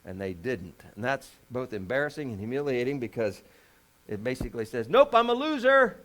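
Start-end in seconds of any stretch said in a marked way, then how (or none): 4.9-6.0 high, loud mock defeat; high and loud mock loser wail; self-mocking loser. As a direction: cry out your failure like a joke. Voiced self-mockery of failure.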